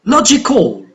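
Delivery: "Logical" with LO stressed, then falling; LO-gi-cal, first syllable of "Logical" lifted. Shown as a demonstration. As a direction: hit the LO in "Logical".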